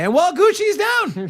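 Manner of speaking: high, loud punchline reveal; mock dramatic. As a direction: spike up high for the joke. Punchline about Gucci guy.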